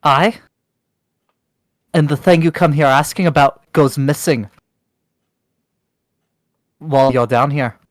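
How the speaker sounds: stern, accusing dwarf matriarch; suspicious dwarf leader. Acted. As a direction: press them hard with suspicion. NPC accusing.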